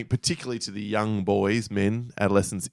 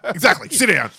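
higher, raised-voice mock order; stern teacher retort; 9 semitones higher, 4 dB louder than usual. Imitating teacher sending pupil back to seat, stern retort. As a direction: snap it out like a fed-up teacher.

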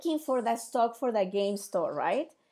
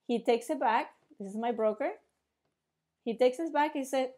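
ordinary delivery

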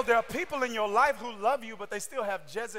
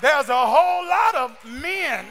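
louder, shouted sermon cadence; preaching at full force; 3 semitones higher, 8 dB louder than usual. Acted. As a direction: drive the point home, preaching. Preacher builds again to shouting.

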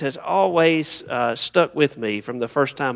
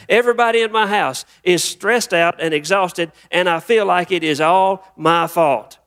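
higher-pitched acted complaint; overwhelmed venting; 5 semitones higher than usual. Exhausted parishioner blaming self and preacher, overwhelmed venting. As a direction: play a stressed churchgoer pouring it all out. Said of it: "begin to just dump everything out".

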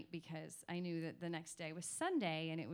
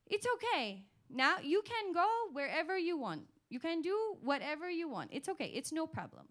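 much higher and louder auntie voice, soothing; Auntie reassuring; 10 semitones higher, 7 dB louder than usual. Retold or imitated. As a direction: play the translator auntie calming her down, pitched high. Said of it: "She said".

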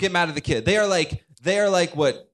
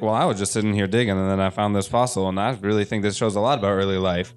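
lower, fast, casual expert; interviewed expert voice; 7 semitones lower than usual. Retold or imitated. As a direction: play the scientist on camera. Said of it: "and they're like".

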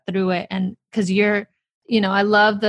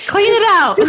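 much higher and louder, cackling along; laughing mock cheer; 13 semitones higher, 11 dB louder than usual. Host joining the pantry joke, much higher and louder, laughing. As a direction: egg the binge on through laughter.

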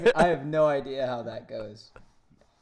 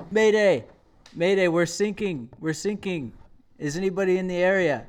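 mock mayday, called out loud and higher; urgent distress call; 5 semitones higher, 6 dB louder than usual. Sinking ship calls for help in a joke, urgent mock distress call. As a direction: call for rescue over the radio, playacted. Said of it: "They send out another distress signal".

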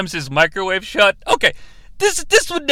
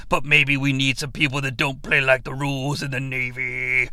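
hammed-up solemn commander's speech, pitched down; mock movie authority; 6 semitones lower than usual. Host parodying a Navy speech about rule breakers, mock-solemn.

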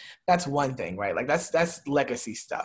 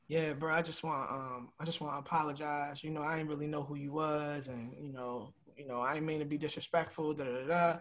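much softer, low, hesitant mumble; sheepish young man, barely above a murmur; quiet apology; 3 semitones lower, 10 dB quieter than usual. Retold or imitated. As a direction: apologize with your head down. Inmate apologizing, head down.

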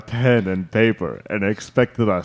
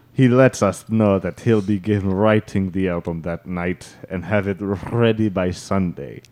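ordinary delivery

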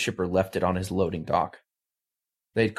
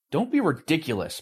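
sharp, higher snap; snapping; 7 semitones higher than usual. Acted. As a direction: cut them off. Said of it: "Baldrick snapped".

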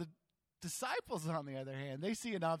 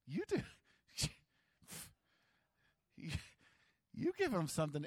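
playful; faster than usual. Shown as a demonstration. Jesus playfully turning the task back on them. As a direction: toss it back with a grin. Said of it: "he probably said it nicer".

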